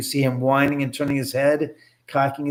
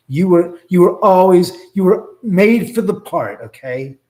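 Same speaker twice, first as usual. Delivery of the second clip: louder and higher, pressing each word; emphatic insisting; 6 semitones higher, 9 dB louder than usual. Real, not acted. Reassuring the restless knife spirit, loud emphatic insistence. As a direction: insist hard that he was made for it.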